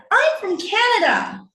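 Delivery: put-on high, loud child's answer; high-pitched little kid, eager; excited little boy. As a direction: pipe up proudly like a five-year-old. Young son answers his mother.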